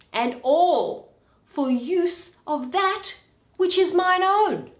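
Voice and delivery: Shylock flaring up at his insults; indignant outburst. Acted.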